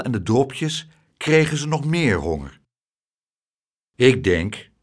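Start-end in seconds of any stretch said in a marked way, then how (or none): 3.9-4.7 weighty, deliberate figuring aloud; measured, pondering opening; thoughtful. A narrator's reading. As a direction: think it over as you say it. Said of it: "zei meneer Tump bedachtzaam".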